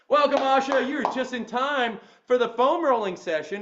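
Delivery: high, loud, peppy trainer voice; Nino the trainer. Retold or imitated. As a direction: greet the class like an upbeat coach. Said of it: "I had to watch a lot of footage of Nino".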